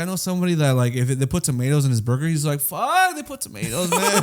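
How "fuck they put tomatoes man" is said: high-pitched exaggerated dismay; mock whining. Friend finding tomatoes in his burger, whining. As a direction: wail it like a petty tragedy.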